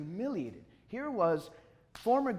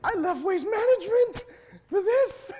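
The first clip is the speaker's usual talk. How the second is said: loud, high-pitched retold outburst; outraged disbelief; 11 dB louder than usual. Applicant told he can't be hired, outraged. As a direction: shout it as if you threw everything away. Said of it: "my mind is racing".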